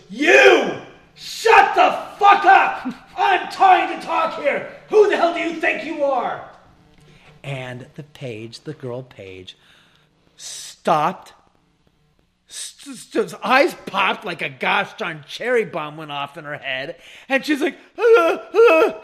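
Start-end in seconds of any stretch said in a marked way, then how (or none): 0.1-6.5 slow, high, full-force angry yell; furious shout. Retold or imitated. Richard turning on a talking page, furious shout. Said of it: "with force and anger". 18.0-19.0 high, loud imitated sobs; mock crying wail. Girl page after being yelled at, mock crying. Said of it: "she starts crying her eyes out".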